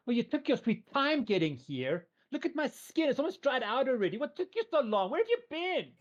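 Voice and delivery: put-on high, whiny impatient teen girl; teenage girl voice. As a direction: pout like a spoiled teenage girl. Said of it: "Oh, we're dealing with a teenage girl".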